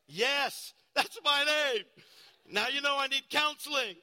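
loud, sheepish confession to the church; flustered outburst. Real, not acted. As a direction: own up to the blunder loudly. Embarrassed confession to congregation.

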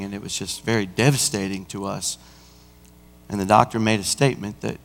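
low, hushed, somber telling; soft, subdued and grave; solemn recollection. Real, not acted. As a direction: drop right down, recalling a death with respect. Recalls a death at the hospital.

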